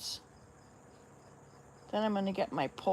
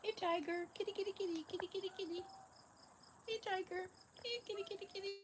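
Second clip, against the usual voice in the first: very high, soft pet-calling voice; calling a cat; 12 semitones higher, 13 dB quieter, faster than usual. Real, not acted. Owner calling Tiger the cat over, high soft call. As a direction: call the kitty softly, way up high.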